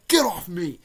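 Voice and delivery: quick angry push-away line; mock yell. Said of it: "He's like".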